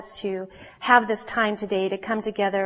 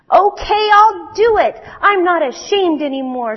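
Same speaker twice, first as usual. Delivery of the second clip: much higher, louder bold little firefly; eager resolve; 9 semitones higher, 8 dB louder than usual. Acted. Firefly answering Ginger, suddenly bold and excited. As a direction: announce it brave and excited.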